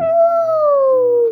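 high excited whoop. Real, not acted. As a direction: cheer it out. Child cheers at story.

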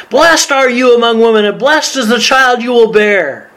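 high, ringing proclamation, slowing at the end; loud exclamation. Acted. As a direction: proclaim the blessing high and exultant. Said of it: "In a loud voice, she exclaimed".

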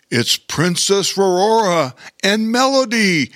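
high, put-on voice of delight; excited exclamation. A narrator's reading. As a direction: exclaim it as an excited storybook king. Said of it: "exclaimed Bear King".

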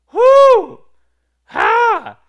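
high piercing whoo, then a loud ha; exultant whoop. Real, not acted. Preacher fired up after the psalm, exultant whoop. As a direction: whoop and bark out a ha.

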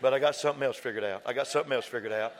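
high, slow, shouted, stretched sermon climax; preaching at full force. Real, not acted. Preacher whooping his climax to the congregation, shouting. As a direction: whoop it out to the rafters.

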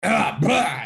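exaggerated blah of throat and nose; imitated sinus clearing. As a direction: mimic noisy sinus clearing. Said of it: "pulling his sinuses out".

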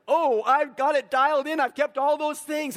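high, loud, eager boast; excited young ruler. Retold or imitated. Rich young ruler boasting to Jesus, excited and loud. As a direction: brag like a kid who aced the test. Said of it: "And he's getting excited".

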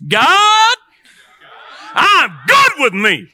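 high, stretched-out shout to God; loud, drawn-out rallying cry; rousing shout. Shown as a demonstration. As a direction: holler it slowly with full force. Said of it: "you gotta say it like this".

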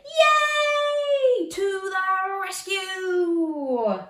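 very high, slow sung cheer; excited cheer. Pretend. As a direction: cheer like a rescue is coming. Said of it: "And you say".

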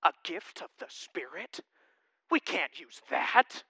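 hushed, scandalized mock gasp of the congregation; congregation's hushed mock outrage at the pastor; outraged whisper. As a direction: mutter it like shocked churchgoers clutching pearls. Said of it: "most people in here go".

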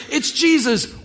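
high, loud eager cry of recognition; excited shout. Retold or imitated. Peter spotting Jesus from the boat, excited loud call. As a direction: call it out like you just spotted him.

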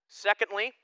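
ordinary talk

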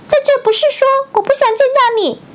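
slipped into the high elf voice; line said too high by mistake; wrong voice take. Shown as a demonstration. Reader misreads Harry's line in Dobby's pitch. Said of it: "啊，不对。".

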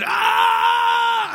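very high loud wail; baby's scream imitated. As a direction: shriek like a hungry baby. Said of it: "As Isaac shouted".

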